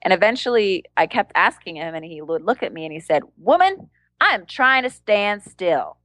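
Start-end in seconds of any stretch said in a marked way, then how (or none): 3.4-6.0 imitated indignant rebuke, high on the first word then drawn out; hammed-up slow irritation; mock exasperated. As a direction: play an annoyed living statue, slowly spelling it out. Retold rebuke from motionless street performer.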